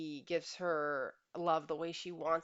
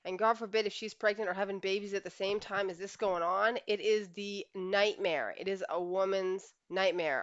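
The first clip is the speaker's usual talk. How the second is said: usual speaking voice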